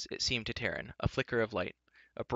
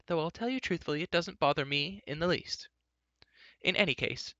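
put-on high, lively girlish voice, defensive; breezy, high-pitched self-justification; chattering Eilonwy; 6 semitones higher than usual. A narrator's reading. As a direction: explain yourself in a higher pitch.

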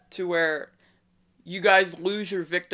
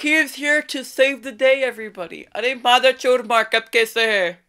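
mock hype; 6 semitones higher, 4 dB louder than usual. Caller keeps mock-hyping the scammer.

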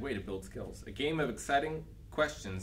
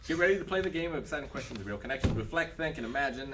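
announcer voice; 3 semitones higher, faster than usual. Acted. Reading game box like an ad.

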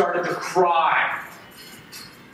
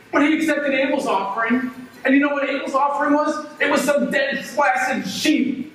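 raised, high-pitched furious complaint; angry rant; 6 semitones higher than usual. Acted. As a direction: vent at your parents, voice climbing.

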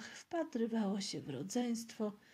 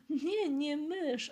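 drawn-out, higher denial; serious; 6 semitones higher, 4 dB louder, much slower than usual. A narrator's reading. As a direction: answer slowly with mock gravity. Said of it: "odparł poważnie". (z powagą, przeciągle)

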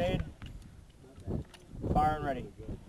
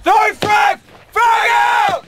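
high, loud shout; grenade warning yell; 10 semitones higher, 6 dB louder than usual. Real, not acted. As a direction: yell the throw warning at full force. Live grenade throw callout.

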